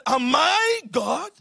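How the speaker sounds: slow, emphatic mock-angry question; preacher lightly acting an angered husband; retold anger. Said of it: "Jacob's anger was kindled against Rachel".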